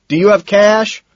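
high, loud yell; loud, urgent query; shouted question. A narrator's reading. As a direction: holler the question over music. Shouted drug deal in club.

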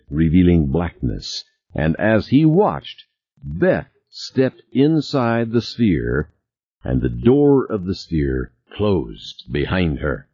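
plain reading voice

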